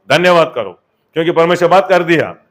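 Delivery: loud, high-pitched rousing proclamation; full-force preaching. Real, not acted. Preacher promising the congregation dead things revive, full-force preaching. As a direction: build to a shouted hallelujah.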